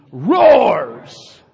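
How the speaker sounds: slow loud growl rising on roars; roaring delivery. Acted. Preacher to congregation, slow loud roar on Satan's attack. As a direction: roar the line slowly.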